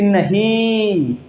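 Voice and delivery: नहीं with the vowel held long and slow; long drawn-out nahin. Shown as a demonstration. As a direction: stretch नहीं out slowly. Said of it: "नहीं को लंबा खींचेंगे".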